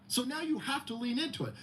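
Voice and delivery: ordinary talk, nothing marked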